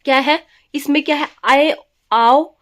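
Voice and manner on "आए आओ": drawn-out आए, आओ held long so the class hears each vowel; slow sound demo. Shown as a demonstration. Teacher slowly sounding out आए and आओ for the class. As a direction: stretch आए and आओ slowly for the students. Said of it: "आए और आओ वाली जब ध्वनि आती है".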